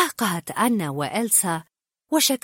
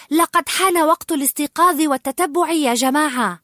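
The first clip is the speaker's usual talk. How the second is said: loud, high rallying announcement; announcing; 8 semitones higher, 6 dB louder than usual. A narrator's reading. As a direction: proclaim it to the whole group. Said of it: "ثم أعلن".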